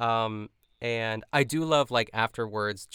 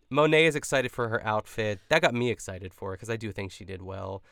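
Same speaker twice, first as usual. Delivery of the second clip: ordinary talk, nothing marked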